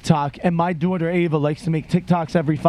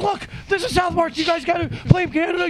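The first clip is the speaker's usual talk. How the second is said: high, bouncy retelling of the joke; sing-song it high like a giddy fan; high, excited retold joke; 10 semitones higher than usual. Singing South Park reference to friends.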